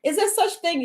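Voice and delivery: high-pitched, imitating a surprised listener; mock disbelief. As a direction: play the doubter who can't believe it. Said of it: "you're like".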